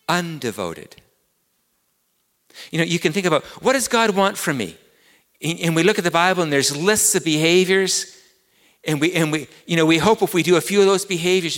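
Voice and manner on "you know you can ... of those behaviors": higher and louder, driving sermon voice; full-force preaching. Acted. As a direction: preach it out to the whole room.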